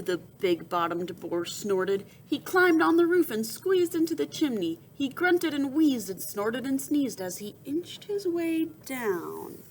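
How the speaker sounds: ordinary delivery, nothing marked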